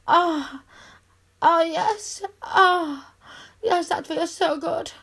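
normal talking voice

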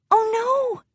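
very high, meek dismay; a tiny high-pitched gasp of worry; timid pony, voice way up high; dismayed Fluttershy. A narrator's reading. Shy pony dismayed in story.

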